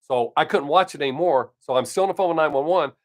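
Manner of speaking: usual speaking voice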